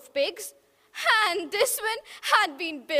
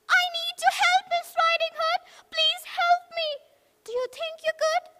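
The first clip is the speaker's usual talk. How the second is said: much higher, desperate pig; pleading little pig; 13 semitones higher, 3 dB louder, faster than usual. Acted. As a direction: beg for help in a high squeal. Pig on the phone.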